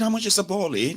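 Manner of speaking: put-on affected London accent, slowing down to explain. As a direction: fake a London accent, groping for the local word. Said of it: "They don't get accent".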